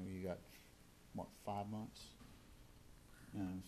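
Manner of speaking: ordinary delivery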